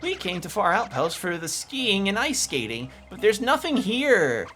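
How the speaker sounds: high sisters' voice. Acted. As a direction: whine about the cold up high.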